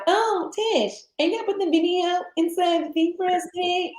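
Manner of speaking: high, wheedling request; team member asking. Retold or imitated. Voices team asking her favours.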